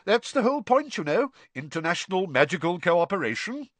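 high-pitched, self-important official; officious, puffed-up delivery; pompous. A narrator's reading. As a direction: pronounce it with smug approval. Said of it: "looking extremely pompous".

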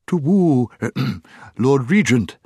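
high hoot, then a throat-clearing address; hooting owl. A narrator's reading. Owl hailing the dwarf in his ear, hooting.

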